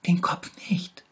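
high, pitiful little tiger voice; sick little tiger. A narrator's reading. Little tiger shows the bear where it hurts, high and pitiful. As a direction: whimper it, poor sick cub. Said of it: "sagte der kleine Tiger".

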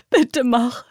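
loud pressing begging; insistent plea. Acted. Terrified girl begs.

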